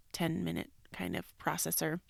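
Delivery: usual speaking voice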